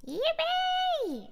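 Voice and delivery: squealed high yippee. Real, not acted. Singer celebrating a song, a high delighted squeal.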